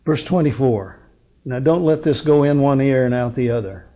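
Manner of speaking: normal talking voice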